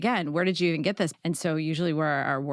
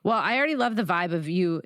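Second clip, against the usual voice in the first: ordinary talk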